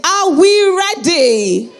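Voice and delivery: high, slow, loud crowd-pumping yell; hyped shout. Real, not acted. Host pumping up the audience, loud drawn-out hype shout. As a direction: stretch it out and lift the whole room. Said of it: "that's the energy".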